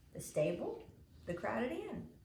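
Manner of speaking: plain reading voice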